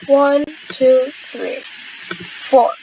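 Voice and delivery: ordinary talk, nothing marked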